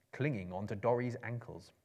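ordinary delivery, nothing marked

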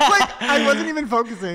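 high, loud, talking through laughter; laughing hard. Real, not acted. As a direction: crack up while getting the words out. Cracking up at his accent.

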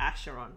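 Acheron said as ASH-er-on; teacher's "sh" Acheron. Shown as a demonstration. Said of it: "that's what my teacher said".

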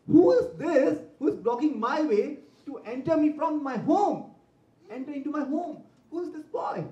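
high, indignant demand; outraged that a boy blocks his way; surprised and angry. A narrator's reading. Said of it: "was surprised and angry".

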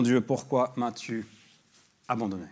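solemn reading, the cry rising loud; scripture reading. Acted. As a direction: read the verse out with weight. Reads scripture aloud, cry quoted.

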